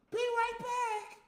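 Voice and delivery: high, slow mocking voice of her leaving; mock Natalie.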